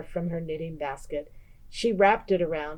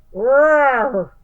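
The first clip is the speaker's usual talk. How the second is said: big loud bear roar; 6 semitones higher, 8 dB louder than usual. A narrator's reading. Bear asked about sour cream or jam, roaring. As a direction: roar out the answer. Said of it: "Old Bear growled".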